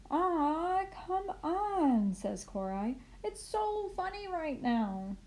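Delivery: put-on high, slow pleading child; high whining kid; whiny protest. A narrator's reading. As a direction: beg to stay a bit longer.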